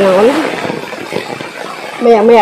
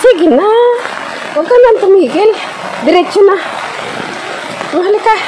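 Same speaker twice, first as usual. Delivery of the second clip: coaxing; 10 semitones higher, 4 dB quieter than usual. Real, not acted. Coaxing the dog onward.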